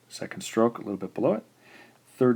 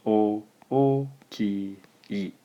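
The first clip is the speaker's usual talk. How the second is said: syllable by syllable; slower than usual. Shown as a demonstration. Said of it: "Four syllables".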